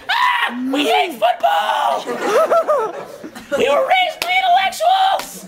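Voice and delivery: very high-pitched squealed battle cry; screaming toddlers. Acted. Toddlers run at the football player, screaming. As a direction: shriek it like a pack of tiny kids, drawn out. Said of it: "run screaming towards him saying".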